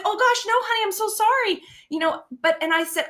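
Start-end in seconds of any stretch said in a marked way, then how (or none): 0.0-1.6 much higher, apologetic mom voice; gushing mock apology. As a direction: re-enact a mom rushing to reassure her child. Mother apologizing to child in story.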